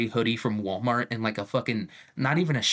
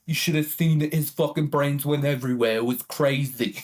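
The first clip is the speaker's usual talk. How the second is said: Luigi Mangione, imagined; 3 semitones higher than usual. Acted. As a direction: dish it out like a mildly hammed-up tattletale. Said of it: "he would have been telling everyone".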